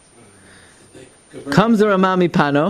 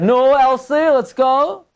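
high coaxing call to the cow; urging the cow; 9 semitones higher, slower than usual. Retold or imitated. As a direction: coax the cow along, pitched up. Farmer urging cow on Shabbos.

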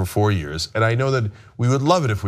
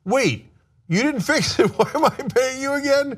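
much higher, mock disbelief; incredulous; 14 semitones higher than usual. Retelling the repair guy's bill, high incredulous mock outrage. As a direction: squeak out the outrage at paying for nothing. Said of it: "I was like".

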